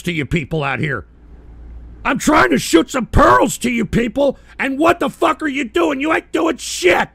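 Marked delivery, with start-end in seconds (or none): 2.0-7.1 higher, louder exasperated yell; angry shout. Real, not acted. Streamer yelling at viewers for doing nothing. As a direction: blast the viewers with it.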